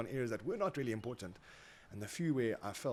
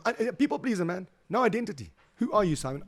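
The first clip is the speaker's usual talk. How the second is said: loud, high self-accusing cry; emphatic outburst; 11 semitones higher, 12 dB louder than usual. Real, not acted. Confessing to the host he was a people pleaser, loud emphatic. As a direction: call himself out with force. Passionate self-judgment on podcast.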